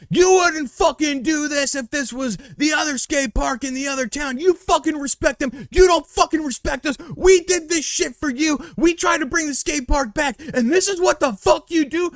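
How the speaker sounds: high, shouted promo-style rant; angry rant. Retold or imitated. Will on the mic ranting at the crowd, high angry shouting. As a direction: tear into them like a pro wrestler. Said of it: "Will just goes off".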